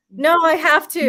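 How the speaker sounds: insistent. Retold or imitated. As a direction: push back emphatically, as if overruling everyone. Acting out defying what everyone says.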